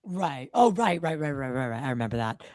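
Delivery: ordinary delivery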